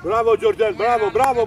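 loud repeated bravos; shouted praise. Real, not acted. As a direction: cheer the player loudly. Coach praising player. (laude strigate tare)

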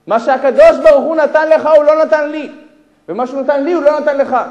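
high, very loud proclamation; emphatic declaration. Retold or imitated. Millionaire explaining his gift to a poor father, emphatic. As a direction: drive each half of the saying home. Stranger repeats his saying forcefully.

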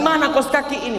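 high, cross demand; mock a grumpy husband's complaint; mildly acted irritation; angry husband. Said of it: "Kalau suami marah".

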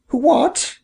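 high, rising startled question; surprised squeak of a question; exclaiming. A narrator's reading. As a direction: exclaim it in a high mother-bird voice. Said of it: "exclaimed Missus Bob".

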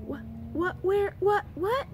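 flustered stammer. Acted. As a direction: sputter in confusion. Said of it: "began Stanley".

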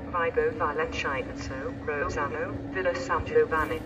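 PA loudspeaker announcement voice; echoing station tannoy; train station announcement. Real, not acted.

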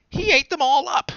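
high, loud indignant accusation; high loud indignant child voice; accusing bunny. A narrator's reading. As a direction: accuse the wolf with I-told-you-so fury. Dot accuses Wolfie.

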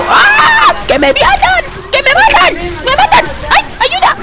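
mock panicked shrieking. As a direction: shriek for help like a hammed-up victim. Shrieking as if being killed.

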